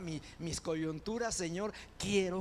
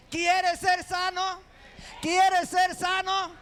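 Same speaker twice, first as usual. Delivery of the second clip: full-force preaching; 11 semitones higher, 10 dB louder than usual. Acted. Preacher shouting the Lord's question at the church, high and loud. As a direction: hammer the question into the crowd.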